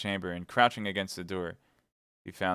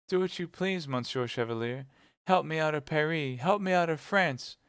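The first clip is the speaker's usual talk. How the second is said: put-on high-pitched pleading young woman; Kitty, pleading; 8 semitones higher than usual. A narrator's reading. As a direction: beg urgently in a high voice.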